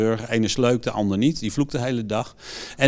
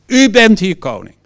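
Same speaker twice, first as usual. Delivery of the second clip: forceful prayer, raised voice; bold declaration; 2 semitones higher, 4 dB louder than usual. Retold or imitated. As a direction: command it with full authority. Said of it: "Nee, maar".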